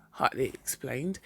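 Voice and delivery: usual speaking voice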